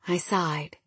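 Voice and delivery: usual speaking voice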